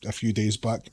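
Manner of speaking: ordinary delivery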